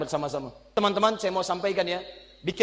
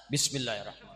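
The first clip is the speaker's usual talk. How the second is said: even softer Bismillahirrahmanirrahim, teasingly muted; mock quieter; 8 semitones lower, 7 dB quieter, much faster than usual. Shown as a demonstration. Preacher answering the heckle by saying Bismillahirrahmanirrahim even softer. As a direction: say Bismillahirrahmanirrahim more quietly as a joke. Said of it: "Kurang jelas".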